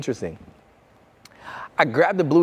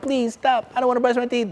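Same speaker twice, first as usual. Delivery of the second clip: higher mock whimpering plea; lightly voiced tearful child; imitating crying kid; 9 semitones higher than usual. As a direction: beg like a kid refusing to brush. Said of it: "They're crying".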